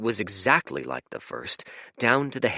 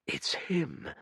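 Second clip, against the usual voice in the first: relieved hush; 8 semitones higher, 6 dB quieter than usual. Acted. Fate relieved on seeing figure.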